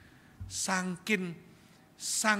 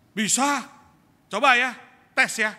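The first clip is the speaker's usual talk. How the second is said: reckless dare; 6 semitones higher, 11 dB louder than usual. Retold or imitated. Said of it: "dia bilang".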